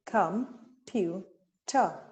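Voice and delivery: Com-pu-ter said very slowly, one beat each; tapped-out syllables. Shown as a demonstration. Teacher tapping syllables on her arm, very slow. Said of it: "how many syllables are in the word computer".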